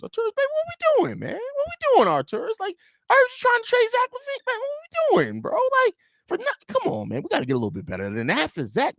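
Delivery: very high, fast mock whine at the GM; squeaky high Jerry. Jerry pleading with Arturas over LaVine, squeaky high mock whine. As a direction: squeal it like a whiny owner.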